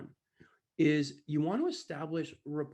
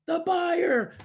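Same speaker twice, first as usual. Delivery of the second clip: high, loud shouted answer; imitated crowd shout; 9 dB louder than usual. Audience answering who wins, shouted. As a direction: holler it back as the audience.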